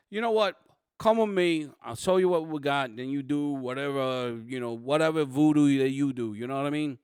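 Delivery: hammed-up New York Italian cop, starting high and loud, then easing. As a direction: play the fed-up New York Italian detective. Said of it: "I went New York Italian on this one".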